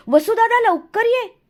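high, happy shout to someone away; joyful calling out. A narrator's reading. Younger brother hailing his elder brother joyfully, calling out. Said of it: "आनंदानं हात उंचावून मला हाकच मारली".

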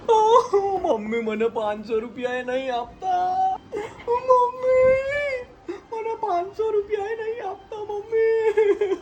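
very high, drawn-out wail; crying. Real, not acted. Wife complaining to mother about money, wailing tearfully. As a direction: cry out in a long whine. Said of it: "આ રડવાનું બંધ કરી દે".

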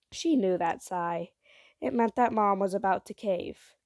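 ordinary delivery

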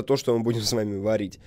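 usual speaking voice

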